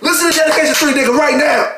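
high, fired-up demand; emphatic urging. Real, not acted. Fan urging friends to play a [name] mixtape, emphatic and high. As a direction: insist on it, pitched right up.

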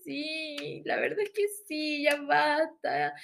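agudo lloriqueo de compañera; queja imitada. Retold or imitated. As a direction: sumarse a la queja, alargando el final. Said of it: "dijo otra". (quejido agudo y exagerado)

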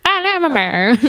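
put-on high sing-song child voice; mock kid voice. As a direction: play the kids' funny way of talking. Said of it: "That's how my kids talk".

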